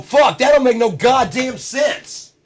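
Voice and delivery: slow, loud, high frustrated yell; angry shout. Retold or imitated. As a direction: yell in disgust like a fed-up voter. Annoyed voter.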